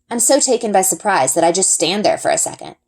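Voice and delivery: plain reading voice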